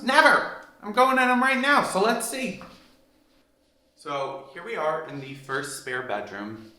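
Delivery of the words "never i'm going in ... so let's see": excited exclamation. Real, not acted. Excited about unused bedrooms.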